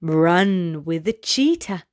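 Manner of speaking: ordinary delivery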